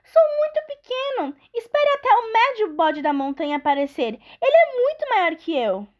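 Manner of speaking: high, small pleading goat; little goat voice. Acted. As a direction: beg like a tiny goat.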